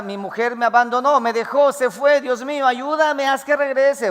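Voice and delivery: high, loud anguished prayer; imitating a desperate man begging for his wife; abandoned husband's plea. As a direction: cry out to God to bring her back.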